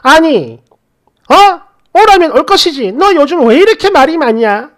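irritated mother-in-law telling off her son's wife; angry mother-in-law. Retold or imitated. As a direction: snap at her for talking back. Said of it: "처음으로 화를 내는 겁니다".

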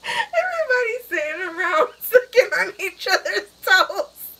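high, loud, laugh-choked voice; constantly laughing. Real, not acted. Picturing a silly Christmas gathering, cracking up loudly. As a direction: squeal the words through real laughter. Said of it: "Just me constantly laughing".

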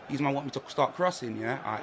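ordinary delivery

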